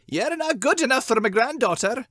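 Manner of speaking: high, mock taunting old man; mocking grandfather. Corey mimics the grandfather's taunt, high mock sneer.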